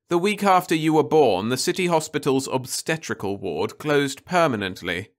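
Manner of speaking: earnest mother. A narrator's reading. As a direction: reveal a hard truth patiently.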